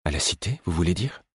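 measured, subdued question; slow voice. A narrator's reading. Queen checking what the dazed man meant, slow voice. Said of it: "demanda Ketriken d'une voix lente".

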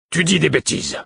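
loud, high-pitched scolding burst; angry outburst. Acted. Father dismisses his son's plan, angry outburst. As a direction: cut the child down angrily.

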